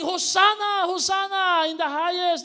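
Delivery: high, loud joyful crowd cry; crowd shout. Retold or imitated. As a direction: cheer it like the welcoming crowd. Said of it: "the people were shouting".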